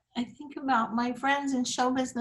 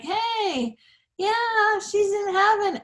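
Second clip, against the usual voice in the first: high, drawn-out happy quote; retold cheerful greeting; 8 semitones higher, slower than usual. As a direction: lift the voice high as if greeting good news. Retold cheerful greeting to bereaved family.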